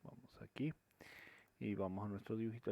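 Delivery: quick whisper to himself; whispered aside. Real, not acted. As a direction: mutter it under the breath while moving on. Aside while moving to drawing. (susurro rápido para sí mismo)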